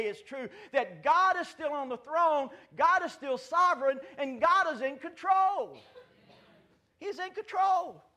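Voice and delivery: loud, high proclaiming, slowing at the end; preaching at full force. Acted. Pastor assuring a troubled congregation, loud and high. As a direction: hammer home that God is in control.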